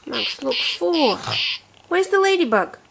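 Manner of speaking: ordinary talk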